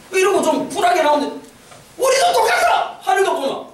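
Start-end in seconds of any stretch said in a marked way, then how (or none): 2.0-3.0 high, drawn-out mock retort; put-on defiance, pitched way up; defiant retort. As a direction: throw it back high and indignant. Voices North Korea's defiant retort.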